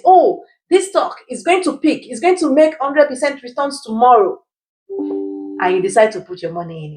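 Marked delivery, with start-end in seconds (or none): 0.0-4.4 high-pitched excited tipster imitation; over-eager mock sales pitch; mock hype. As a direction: hype it like an internet stock tout. Mimics internet stock tipster's hype.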